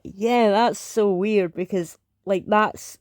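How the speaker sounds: ordinary talk, nothing marked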